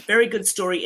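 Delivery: ordinary delivery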